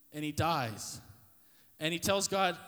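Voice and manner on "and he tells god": ordinary talk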